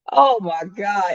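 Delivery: ordinary talk, nothing marked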